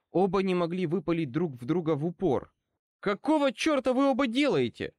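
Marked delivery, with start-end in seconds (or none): 3.0-4.9 high, strained outcry; сдавленный крик. A narrator's reading. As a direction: demand it, high-pitched and alarmed. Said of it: "прохрипел я". (возмущённо, высоким сдавленным голосом)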